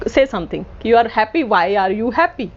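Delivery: usual speaking voice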